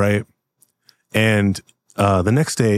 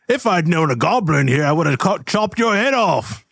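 angry dwarf; 9 semitones higher than usual. Acted. Dwarf threatens the goblin owner, high and blustering.

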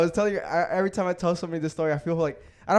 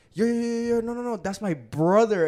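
higher, hurried pleading to stop; lightly voiced girl rushing in to stop it; imitating friend panicking; 6 semitones higher, 4 dB louder than usual. Diana rushes in telling him it's her brother: urgent pleading.

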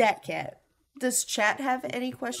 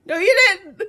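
high, loud no you didn't; loud protest; 11 semitones higher, 11 dB louder than usual. Real, not acted. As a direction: shout it back, indignant. Arguing over the answer.

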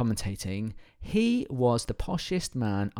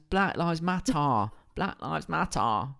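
slow, clipped-free British take on the slogan; British accent; 4 semitones higher, slower than usual. Retold or imitated. Quoting the British protesters, in a slow British accent. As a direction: imitate how the British say it, slowly. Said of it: "not what it sounded like in the U S".

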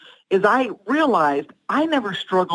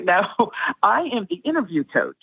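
ordinary talk

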